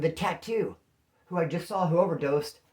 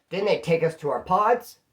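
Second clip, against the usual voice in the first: usual speaking voice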